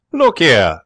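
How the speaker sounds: loud high protest; angry challenge. Acted. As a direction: snap back at him loudly. Ralph confronts Jerry.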